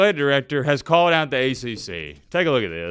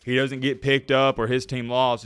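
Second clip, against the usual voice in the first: normal talking voice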